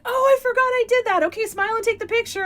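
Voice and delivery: high, quick breezy mock voice; casual celebrity. Actor brushing off fan's compliment, high breezy mock voice. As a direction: brush it off lightly and hurry on. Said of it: "And he's like".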